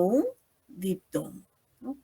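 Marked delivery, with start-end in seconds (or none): none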